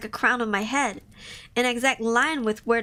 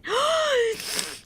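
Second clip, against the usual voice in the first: high sharp intake of breath; startled gasp; 11 semitones higher than usual. Real, not acted. Streamer gasps at the pain scene.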